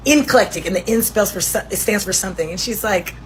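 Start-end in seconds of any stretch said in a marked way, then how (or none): 0.1-0.7 Eclectic said with an extra n-sound at the start, like inclectic; mispronounced eclectic. Retold or imitated. Cam Newton insists on his version, confidently wrong. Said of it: "He goes".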